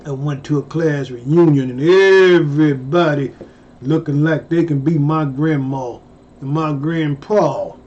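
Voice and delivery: usual speaking voice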